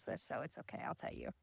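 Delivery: hushed, breathy aside to viewers; whispered aside. Real, not acted. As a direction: drop to a secret whisper. Aside after embarrassing confession.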